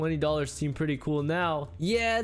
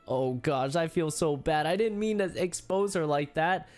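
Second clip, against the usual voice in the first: normal talking voice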